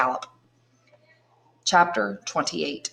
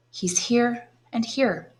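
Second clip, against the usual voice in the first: slow, raised, gentle reply; tender; 6 semitones higher, much slower than usual. A narrator's reading. Haydée remembers her father, slow and tender. Haydée smiles, hand on heart.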